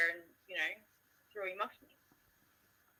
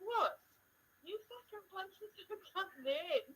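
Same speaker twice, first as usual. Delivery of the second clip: mock disbelief; 12 semitones higher than usual. Her own retort, retold.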